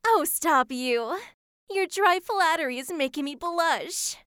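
usual speaking voice

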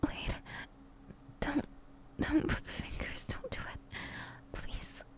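whispering pleas. Acted. Aroused pleading in restaurant.

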